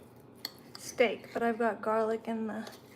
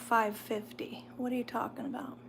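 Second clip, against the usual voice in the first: quick unvoiced whisper; 5 dB quieter than usual. Real, not acted. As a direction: whisper it fast, half to yourself. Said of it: "repeat as whisper".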